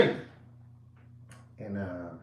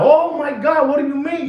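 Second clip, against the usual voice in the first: shocked; 8 semitones higher than usual. Retold or imitated. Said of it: "That one now".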